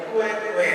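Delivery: high, even quack from afar; imitated duck quack. Preacher mimics a sound heard from afar, imitated quacking. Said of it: "Dari kejauhan terdengar lagi".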